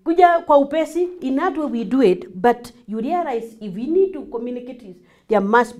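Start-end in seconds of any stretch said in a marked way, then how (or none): 0.0-1.1 high, loud hurry-up call; urgent summons. Acted. Parent hurrying a child over, urgent. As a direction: press them to come at once. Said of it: "it's even urgent".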